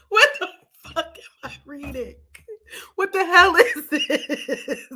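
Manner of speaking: high, breaking through laughter; laughing in disbelief. Real, not acted. Vlogger reacting to her book, cracking up with laughter. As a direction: she can barely get the words out for laughing. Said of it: "I'm literally in tears".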